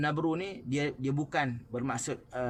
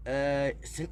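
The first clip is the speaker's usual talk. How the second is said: usual speaking voice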